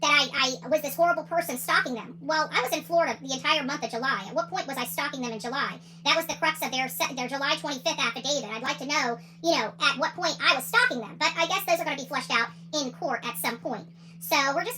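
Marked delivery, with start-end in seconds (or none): none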